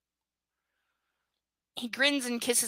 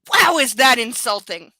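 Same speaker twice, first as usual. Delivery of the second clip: incredulous; 11 dB louder than usual. Real, not acted. Reacting incredulous to the line.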